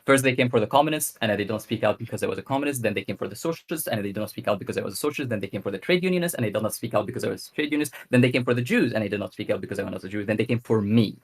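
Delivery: fast, run-on recitation of a quote; quick reading. Real, not acted. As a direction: rattle through the quotation, pushing straight on. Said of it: "I'm gonna read it quickly".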